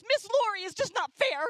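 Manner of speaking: high, loud whining protest; mock whiny girl crying unfair; whiny teen complaint. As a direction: wail it like an upset seventh grader. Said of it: "whiny voices".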